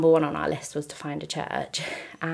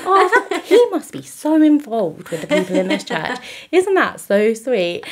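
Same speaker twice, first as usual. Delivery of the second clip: high, naive voice through laughter; higher, laughing innocent gush; naive musing; 7 semitones higher, 7 dB louder than usual. Retold or imitated. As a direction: play your clueless past self, amused. Her naive past thought, laughing.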